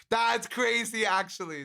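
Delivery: blurted-out amazement; surprised. Real, not acted. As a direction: caught off guard by chat. Reacting to chat message.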